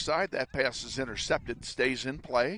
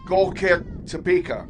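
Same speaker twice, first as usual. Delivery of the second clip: louder, higher formal announcement; stadium announcer; 5 semitones higher, 8 dB louder than usual. Real, not acted. Formal announcement after the call.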